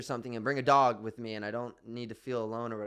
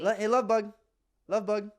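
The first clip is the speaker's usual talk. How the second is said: casual attempt; 9 semitones higher, 7 dB louder than usual. Shown as a demonstration. Asked to call the cat casually, attempted casual voice. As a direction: still raised, louder cat call. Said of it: "Say casually".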